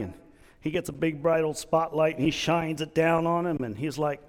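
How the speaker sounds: ordinary delivery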